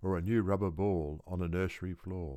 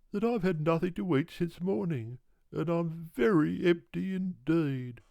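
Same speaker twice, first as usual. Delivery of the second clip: high, louder pleading kangaroo voice; hungry old kangaroo, apologising; 9 semitones higher, 3 dB louder than usual. Acted. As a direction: beg your lordship's pardon in a high, hungry squeak.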